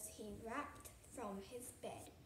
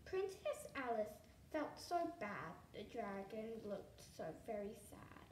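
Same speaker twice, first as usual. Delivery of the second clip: ordinary delivery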